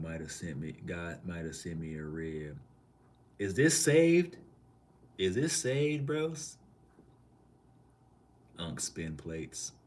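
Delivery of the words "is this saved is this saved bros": much higher and louder, eager recognition; excited question. Real, not acted. Asking chat whether a regular is back, excited and much higher. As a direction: call out to chat, thrilled to spot him.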